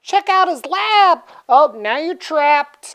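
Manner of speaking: high, slow sinister tease; sly villainess. Retold or imitated. Infectia traps the man, high and sly. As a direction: lure him then spring the trap. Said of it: "She's like".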